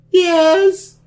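high, put-on falsetto answer; dude talking like a girl. Sasha confirms her name on the phone, fake girl voice. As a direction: imitate a man faking a girl's voice. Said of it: "sound like a dude talking like a girl".

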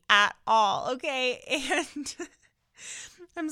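apologising through a laugh; real laughing. Real, not acted. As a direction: break into a giggle, then keep talking. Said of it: "I'm just laughing thinking about it".

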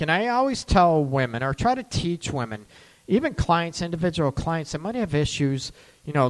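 ordinary talk, nothing marked

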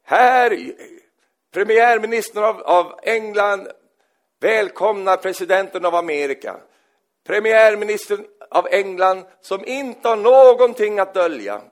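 loud, high, slow mock-formal proclamation; grand announcement. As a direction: announce yourself pompously. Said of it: "Då säger han". (högtidligt och skämtsamt tillkännagivande, högt)

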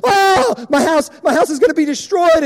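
hammed-up crying panic; tearful hysterical wail. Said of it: "tears are coming down her face".

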